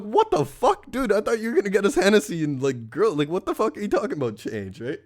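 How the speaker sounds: mock outraged thought; inner disbelief. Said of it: "in my head".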